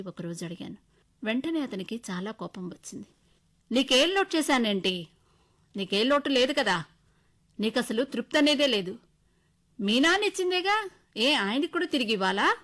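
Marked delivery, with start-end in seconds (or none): 3.7-12.6 louder, scornful retort; arrogant anger. Acted. Husband scolding wife for asking, loud arrogant anger. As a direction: throw it back at her with contempt. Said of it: "అంటూ పొగరుగా అన్నాడు".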